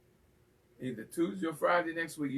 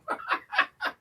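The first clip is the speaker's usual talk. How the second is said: shrieking laugh, way up high; high squealing laugh. Real, not acted. Host squeals at being told to keep his day job. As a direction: squeal with delight.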